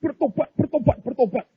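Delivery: high, insistent chant of repentance; mock pleading cry. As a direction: imitate a desperate man crying out his promise. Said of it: "dia teriak-teriak begitu". (pura-pura berseru memohon, suara tinggi)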